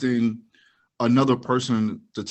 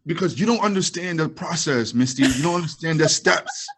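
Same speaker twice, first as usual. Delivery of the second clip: higher, patronizing lecture voice; mock condescending explainer; 5 semitones higher, 3 dB louder than usual. Mocking critics who explain the process to her. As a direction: talk down like a smug defender.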